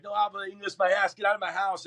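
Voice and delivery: high-pitched retold outrage; indignant mimicking comeback; angry retort. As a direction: throw their excuse back, punching the end. Throws intruders' excuse back angrily.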